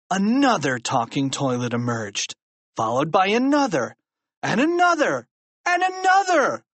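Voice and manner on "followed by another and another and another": each phrase higher than the last; climbing, mounting excitement; escalating alarm. A narrator's reading. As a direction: build from storytelling up to a high cry. Narrator builds as monsters multiply.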